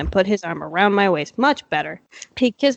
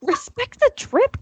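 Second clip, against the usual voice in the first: mock high voice; 14 semitones higher, slower than usual.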